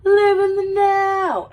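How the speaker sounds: high, drawn-out holler; loud, stretched catchphrase; movie quote shout. Retold or imitated. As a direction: belt it out high and long. Acts out a favourite movie line.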